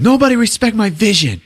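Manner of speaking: high mock yell of protest; imitated yelling. Director protests to Greg about his vision, yelling. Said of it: "he's yelling at Greg".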